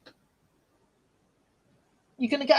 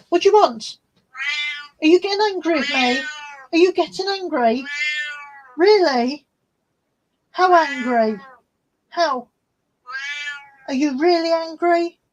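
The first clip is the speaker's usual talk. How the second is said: higher, louder playful teasing of the cat; teasing pet talk; 4 semitones higher, 4 dB louder than usual. Real, not acted. Owner teasing her grumpy cat in high playful pet talk.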